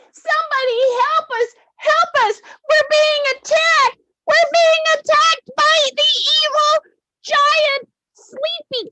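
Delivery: hammed-up cry for help; mock panic. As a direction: yell for help in mock terror. Victims calling for help.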